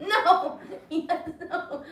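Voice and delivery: real laughter through single words; laughing as it fails. Real, not acted. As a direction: giggle helplessly while losing balance. Toppling out of the pose.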